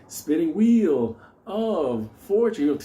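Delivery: slow, stretched game-show call; mock Wheel of Fortune chant; game show imitation.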